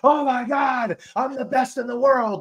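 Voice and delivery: high, loud, slow triumphant cry; hammed-up online win yell; mock celebration. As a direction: celebrate like a blubbering idiot. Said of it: "you're like".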